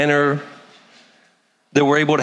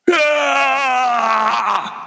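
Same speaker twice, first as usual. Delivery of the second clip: short hammed-up shout, high; imitating a zealot's cry; mock yell; 10 semitones higher than usual. Mimics zealous believer yelling.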